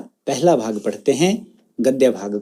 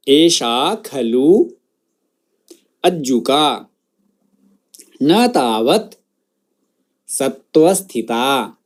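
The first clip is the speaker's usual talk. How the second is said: word-by-word model. Shown as a demonstration. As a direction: pronounce each word separately for students to echo. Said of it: "मेरे बाद उस शब्द का उच्चारण".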